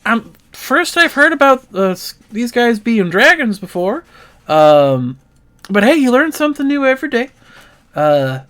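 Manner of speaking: high, lively country clerk bragging; excited shopkeeper. Acted. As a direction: show off the beasts with pride. GM voices the shopkeeper NPC.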